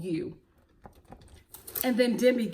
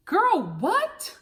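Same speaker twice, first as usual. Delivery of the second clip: shocked disbelief; 9 semitones higher, 3 dB louder than usual. Real, not acted. Vlogger reacting to Courtney's behavior, shocked and high. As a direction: shoot the "what" way up in disbelief.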